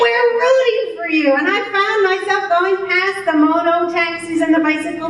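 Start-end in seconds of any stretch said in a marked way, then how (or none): 0.0-1.3 higher, quick cheer of encouragement; retold cheering. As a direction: cheer someone on, pitched up and fast. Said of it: "they were smiling at me".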